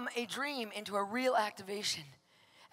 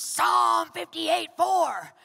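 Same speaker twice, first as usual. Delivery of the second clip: slow, loud and higher booming reference; God's shout again, louder; 5 semitones higher, 9 dB louder, much slower than usual. Retold or imitated. Pastor repeats God's wake-up call, louder slow booming shout. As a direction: call it down even harder, word by word. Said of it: "he shouted this at me".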